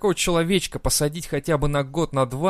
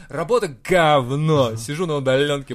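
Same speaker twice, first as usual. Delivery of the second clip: put-on drunk voice; 4 dB louder, slower than usual. Drunk call to alcoholics hotline.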